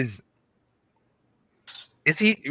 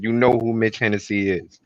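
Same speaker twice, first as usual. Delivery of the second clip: ordinary talk, nothing marked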